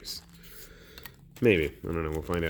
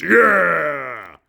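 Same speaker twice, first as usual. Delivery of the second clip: excited cheer; 8 semitones higher, 10 dB louder than usual. Acted. Cheering after reading free mini.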